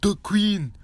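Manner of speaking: high, loud mock scream; imitated meme scream. Imitating Knuckles avatars spotting a female avatar, mock scream. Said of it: "tongue clicking and screaming".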